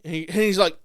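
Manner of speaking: normal talking voice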